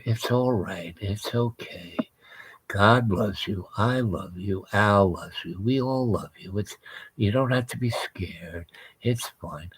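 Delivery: hushed, soft and tender, sinking to a whisper; soothing whisper. Retold or imitated. Wife whispering comfort to the beast, soft and hushed. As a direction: soothe it gently, almost whispering. Wife calming creature, retold again.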